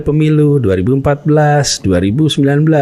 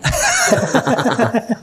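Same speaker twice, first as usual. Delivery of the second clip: a sudden high laugh breaking out; high burst of laughter; 13 semitones higher than usual. Real, not acted. Guest laughing at his own multitasking joke to the host, high laugh.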